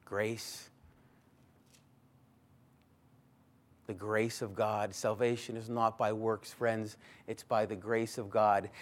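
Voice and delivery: soft, low, reverent; softly sincere; hushed. Real, not acted. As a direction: let it settle quietly.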